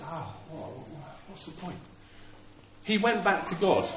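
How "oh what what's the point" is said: very soft, defeated mumble; acted dejection. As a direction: play a man giving up, voice dropped away. Moses acted as discouraged.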